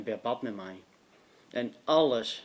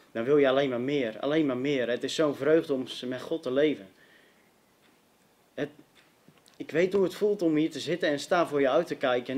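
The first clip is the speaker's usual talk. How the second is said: raised, louder testimony appeal; impassioned preaching; 3 semitones higher, 6 dB louder than usual. Acted. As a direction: press it urgently on the congregation.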